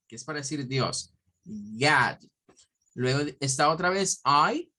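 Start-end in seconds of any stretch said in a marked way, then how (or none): 1.4-2.2 low, long "Yad" with an open O; O with A mouth. Shown as a demonstration. Teacher repeats the word for God, "Yad" as open A-like O. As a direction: say "Yad" as an O through an A-shaped mouth. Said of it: "con nuestra boca en forma de A".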